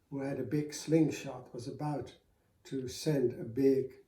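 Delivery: ordinary delivery, nothing marked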